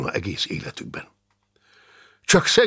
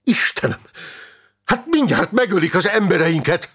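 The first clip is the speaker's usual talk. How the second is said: high, urgent cry of dismay; alarmed exclamation; 9 semitones higher, 3 dB louder than usual. Acted. Comrade fearing his men will be killed, alarmed exclamation.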